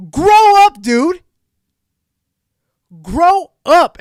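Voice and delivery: loud, high "grow up"; angry shout. Real, not acted. Scolding a drama channel host, furious shout. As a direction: bark it like a scolding. Telling the YouTuber off.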